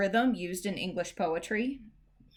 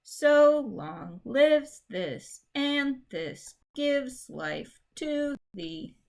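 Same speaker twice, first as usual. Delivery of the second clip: slow, with the beat on the first syllable of each pair, starting higher; trochaic reading; 6 semitones higher, much slower than usual. Shown as a demonstration. Teacher testing trochaic meter, slow and stilted. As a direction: hammer the DUM-da pattern. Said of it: "Let's try trochaic in the second line".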